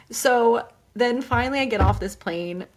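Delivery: ordinary talk, nothing marked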